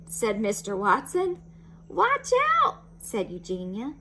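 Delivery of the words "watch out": high, loud warning; warning shout. Acted. Neighbour warns.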